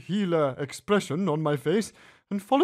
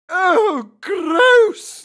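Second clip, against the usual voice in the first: high, loud disgusted shriek; screeching oh gross; 13 dB louder than usual. A narrator's reading. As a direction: kids recoiling in disgust. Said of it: "they shrieked".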